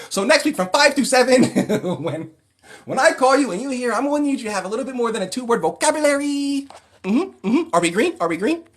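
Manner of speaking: mock-chide the guest in a shrill showman voice; imitating a flamboyant host. Keeps doing the host voice.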